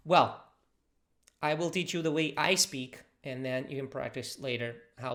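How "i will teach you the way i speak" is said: ordinary talk, nothing marked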